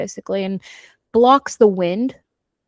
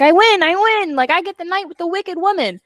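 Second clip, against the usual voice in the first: high, loud gloating boast; 8 semitones higher, 4 dB louder than usual. Retold or imitated. Destroyer gloating as he grabs Kingsley, high. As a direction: crow over the win. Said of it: "and he's like".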